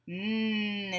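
"Mm" held as one nasal hum; a soft, drawn-out "Mm"; isolated end sound. Shown as a demonstration. Teacher showing the end sound, held consonant. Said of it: "is the sound at the end".

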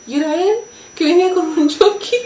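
high, loud burst of recognition; overwhelmed excitement. Real, not acted. Survivor telling how he turned out alive, high and excited. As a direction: let the voice leap up as you relive spotting him. Reliving the sight of him alive.

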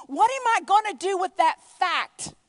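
loud, high, punched out word by word; urgent question. Acted. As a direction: cry out the question with force. Preacher voicing Saul's urgent question.

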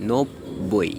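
নব্বই split as nob-boi; nob-boi, pulled apart for নব্বই; b fully sounded. Shown as a demonstration. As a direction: hold the doubled b in নব্বই. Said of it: "ব উচ্চারিত হবে".

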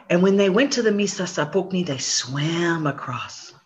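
usual speaking voice

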